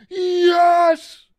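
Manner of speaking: long, high, full-force victory scream; reenacted burst of joy; loudest yell. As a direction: bellow it high and long in triumph. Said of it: "I immediately just go".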